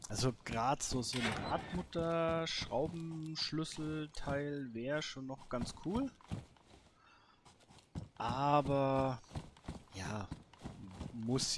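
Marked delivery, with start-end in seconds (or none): none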